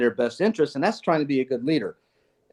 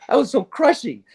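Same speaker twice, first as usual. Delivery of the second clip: mock regret; 10 semitones higher, 4 dB louder than usual. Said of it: "and I look back, "Oh".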